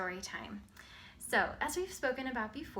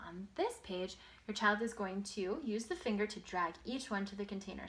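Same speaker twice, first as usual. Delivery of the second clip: usual speaking voice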